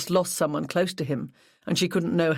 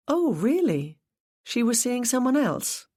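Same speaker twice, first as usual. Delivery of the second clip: higher, quick interest; surprised; 8 semitones higher than usual. A narrator's reading. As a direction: perk up at the news. Detective caught off guard.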